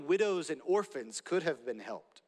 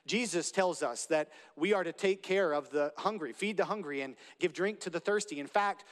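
ordinary delivery

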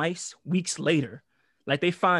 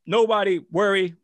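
booming call to calm down; teacher announcing to the crowd, loud and sure; loud reassurance; 4 semitones higher, 8 dB louder than usual. Retold or imitated. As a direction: take charge of a panicked hallway. Country teacher takes charge.

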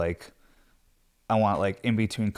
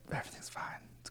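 acted hushed whisper; whispered reassurance; 19 dB quieter, faster than usual. Parent pretending to the kids, acted whispered reassurance. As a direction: whisper it as if covering up fear.

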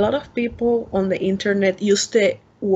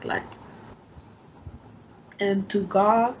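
ordinary talk, nothing marked

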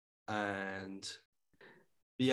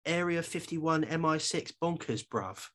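mock London accent; 7 semitones higher, slower than usual. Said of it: "he describes something as".

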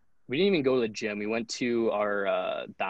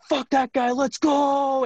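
rowdy rally cry; 6 dB louder, slower than usual. Retold or imitated. Captain rallies team after coach leaves.

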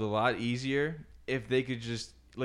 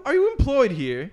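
higher and louder, incredulous sarcasm; sarcastic mock question; 10 semitones higher, 6 dB louder than usual. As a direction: needle the customer with mock disbelief. Mocking the customer.

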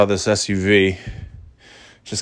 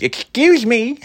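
much higher, off voice that's then rejected; wrong impression of driver; 14 semitones higher, slower than usual. Narrator's wrong first attempt at the delivery woman's voice. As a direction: try a voice for her and miss. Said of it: "No, that wasn't, that wasn't it".

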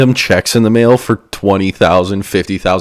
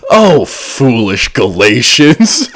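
slow, louder mock outrage while laughing; hammed-up angry reading; 4 semitones higher, 5 dB louder, much slower than usual. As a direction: overplay the audiobook's fury, laughing. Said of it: "he was just like".

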